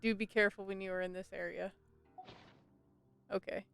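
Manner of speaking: usual speaking voice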